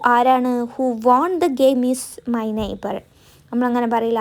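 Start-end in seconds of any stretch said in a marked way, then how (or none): 0.6-3.0 each word spaced for writing; slow dictation. Shown as a demonstration. Teacher dictating a sentence to students, very slow. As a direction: dictate slowly so students can copy. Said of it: "അപ്പോൾ നമ്മൾ പറയാം".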